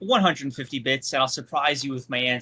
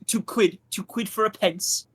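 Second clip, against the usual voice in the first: mock British accent, tagging yeah; put-on British accent. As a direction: play a cheeky cockney. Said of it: "Why did you say man like that?".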